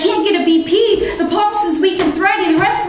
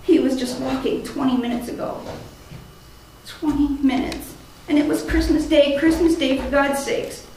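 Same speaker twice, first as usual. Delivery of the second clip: quiet grief; 3 semitones lower, 6 dB quieter than usual. Acted. Grief after failed rescue.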